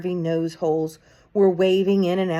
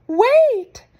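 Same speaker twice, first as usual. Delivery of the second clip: much higher, loud shrieking cry; 10 dB louder than usual. A narrator's reading. Witch in the back row stops the crowd, shrieking. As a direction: shriek it out to stop everyone. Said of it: "shrieked one of the witches".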